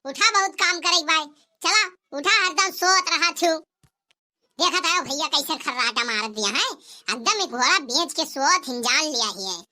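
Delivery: ordinary talk, nothing marked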